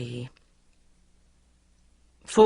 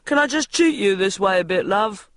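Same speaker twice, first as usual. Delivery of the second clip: casual cameraman; 5 semitones higher, 4 dB louder than usual. A narrator's reading. Cameraman directing.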